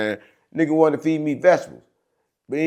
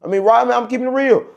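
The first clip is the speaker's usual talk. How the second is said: boastful; 8 semitones higher, 4 dB louder than usual. Real, not acted. Boasting, pitch jumps up.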